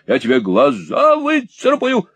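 high furious threat; high screeching rage; shrieking. Acted. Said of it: "взвизгнула женщина".

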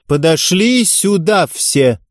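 slow, high, loud summons to everyone; head coach shouting, drawn out; loud call. Acted. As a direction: call the whole group over. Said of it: "громко произнесла".

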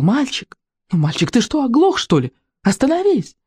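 high exasperated calling, rising to a shriek; yelling with all her might. A narrator's reading. Saleswoman chasing a deaf-seeming boy, yelling. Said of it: "кричала уже изо всех сил".

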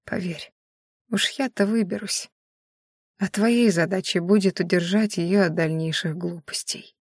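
soft, weakened, breath-saving murmur; barely audible whisper. A narrator's reading. Dying Maiari urges Viedash to forget her, faint whisper. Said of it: "едва слышно прошептала Маяри".

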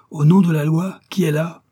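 high, loud summons; shouted challenge. A narrator's reading. Commissioner with revolvers ready to fire, shouting. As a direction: demand it through the door. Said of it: "cria le commissaire".

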